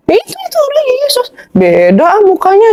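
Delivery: high-pitched mock objection; disputing retort. Guest imitates his debate opponent rejecting the photo, disputing.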